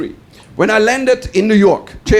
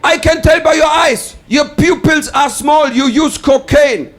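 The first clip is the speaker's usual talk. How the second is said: high, accusing mock shout; hammed-up officer yelling an accusation; shouting customs officer; 6 semitones higher, 4 dB louder, slower than usual. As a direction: shout it deliberately, each charge on its own. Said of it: "he shouted at me".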